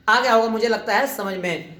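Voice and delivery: ordinary delivery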